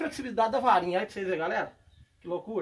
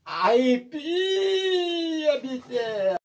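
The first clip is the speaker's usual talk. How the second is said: high cry then long drawn bitela; excited shout; 6 semitones higher, 4 dB louder, much slower than usual. Real, not acted. Showing off a giant fish on camera, whooping. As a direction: whoop it out, stretch the last word slowly.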